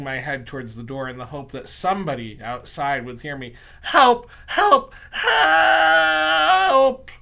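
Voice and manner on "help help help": high, loud cries, the last long drawn out; escalating shrieks for help; terrified screaming. Acted. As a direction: scream for someone outside to hear. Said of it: "I began to scream".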